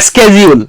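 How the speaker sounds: "স্কেডিউল" said with a hard sk start, skedule; "স্কেডিউল" as skedule, American way; American schedule. Shown as a demonstration. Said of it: "আমেরিকানরা বলে".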